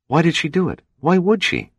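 puzzled Gunn. Acted. Gunn wondering aloud about the stowaway, bewildered. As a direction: ask it baffled, reaching up.

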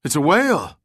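high, excited lookout's call; shout. A narrator's reading. Somebody in boat sights dark object, shouting. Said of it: "somebody shouted".

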